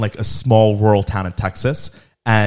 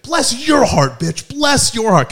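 much higher, drawn-out mock sweetness; hostile bless your heart; 12 semitones higher, 3 dB louder, much slower than usual. Man retorting to the mom in a fight, mock-sweet hostility. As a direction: spit it back sweetly with venom. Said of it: "trying to say fuck you".

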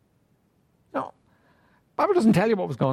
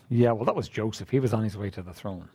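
low, quiet, dismissive mock objector; a hint of a sulky excuse-maker; 11 semitones lower, 5 dB quieter than usual. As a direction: brush it off like a grumbling skeptic.

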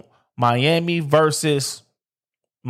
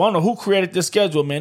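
ordinary delivery

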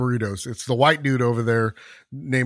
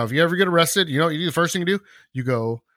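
ordinary talk

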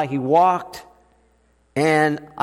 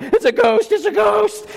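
high-pitched frightened squeal, rising higher; mock screaming panic. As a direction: shriek it like a terrified child. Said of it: "we're sitting there screaming".

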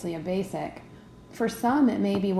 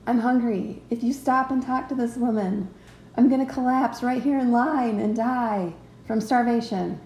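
higher-pitched, mock-dramatic hungry body; complaining body; 3 semitones higher, 4 dB louder than usual. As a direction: play the hungry body, whining about collapsing in line. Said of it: "Your body starts to speak to you".

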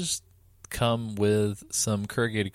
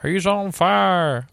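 slow drawn-out hype; hype man; 8 semitones higher, 4 dB louder, much slower than usual. Pretend. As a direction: announce him like a champion. Hyping co-host's tweets.

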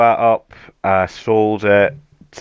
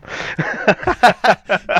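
bashful laugh; 13 semitones higher, 3 dB louder than usual. Real, not acted. Guest laughs at flattering intro.

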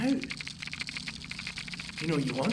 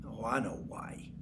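whisper; 9 semitones lower, 11 dB quieter than usual. Acted. Bitter aside.

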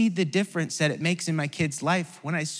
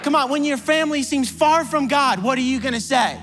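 much higher, louder preaching shouts; preaching at full force; 9 semitones higher, 5 dB louder than usual. Acted. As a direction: rouse the congregation with each question.

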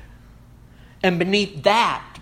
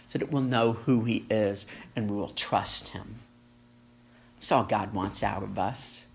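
tender hushed; 7 semitones lower, 6 dB quieter than usual. Real, not acted. Tender sermon close.

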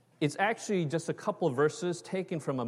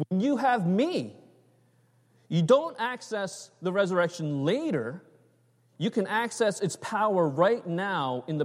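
a higher, urgent voice of Jesus, earnest and insistent; a preacher voicing Jesus who pleads with Martha to see him; Jesus speaking to Martha; 5 semitones higher than usual. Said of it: "He's saying".

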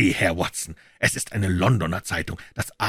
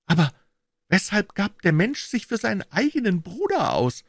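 Pycroft's higher voice; 13 semitones higher than usual. A narrator's reading. Pycroft asks Holmes.

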